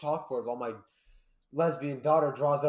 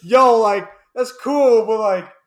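much higher, louder excited yell; excited shout; 8 semitones higher, 17 dB louder, slower than usual. Retold or imitated. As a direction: shout it with delighted surprise. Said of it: "I'd be like".

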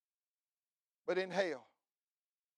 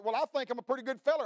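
a fast, smug mock of a proud sinner; smug self-righteous quote; 8 semitones higher, 7 dB louder, faster than usual. Preacher mocking a self-satisfied listener, fast and smug. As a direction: toss it off quickly like a man pleased with himself. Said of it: "Your righteousness is as a filthy rag".

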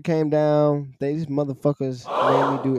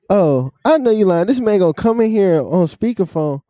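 loud disbelief; 7 semitones higher, 9 dB louder than usual. Real, not acted. Disbelief at manager's call.

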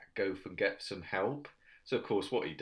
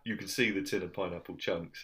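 ordinary talk

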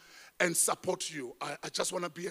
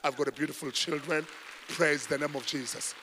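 ordinary talk

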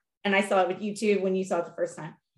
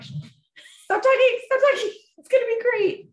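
high, loud and very slow desperate plea to stop spoiling; pleading hush; 6 dB louder, slower than usual. Retold or imitated. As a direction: beg him to shut up before the spoiler, dragged out. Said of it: "I'm like".

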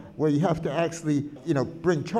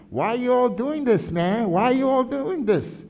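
higher and louder, a hint of desperate pleading; pleading shout; 6 semitones higher, 4 dB louder than usual. Quoting a protester begging the crowd, raised and pleading. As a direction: call out why are you all doing this. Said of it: "the next guy near him who says".

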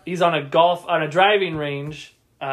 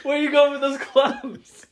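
slow, high shouted quote; raised, drawn-out challenge; mock yell; 8 semitones higher, slower than usual. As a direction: bark it like a startled golfer. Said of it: "He's like".

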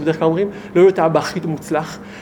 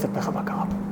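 hushed secret whisper, a bit quick; 11 dB quieter than usual. Retold or imitated. Tzadik confiding to the visitor what happened, whispered.

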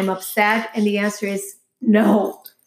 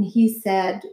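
usual speaking voice

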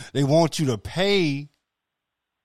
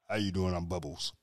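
very soft, low mock introduction; deep-voiced man; 5 semitones lower, 12 dB quieter than usual. Parodying a man named Bubbles, low soft mock seduction. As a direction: sink low and quiet for a silly name. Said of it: "three octaves lower than mine".

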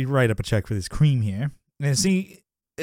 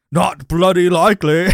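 loud, higher, hammed-up Cockney; bad Cockney accent; 5 semitones higher, 8 dB louder than usual. Answering "let's hear yours" with a worse, louder Cockney. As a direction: belt out a terrible Cockney imitation. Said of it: "Okay, well, let's hear yours".

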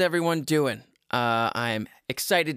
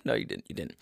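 put-on stern old father; 9 semitones lower, 8 dB quieter, much faster than usual. Father low voice.

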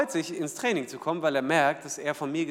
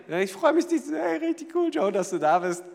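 much higher, bright burst of joy at seeing him; delighted greeting; 13 semitones higher, 6 dB louder than usual. Acted. Coach welcoming star player Joe, overjoyed. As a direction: greet him like your favourite player just walked in.

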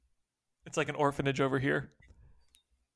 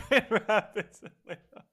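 laughing; 6 semitones higher than usual. Real, not acted. Laughing through the repeated joke.

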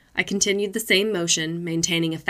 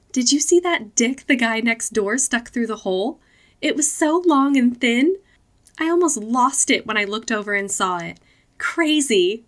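put-on giddy girlfriend voice; 6 semitones higher than usual. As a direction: gossip like a shocked, giddy girl. Lily giddy in the car.

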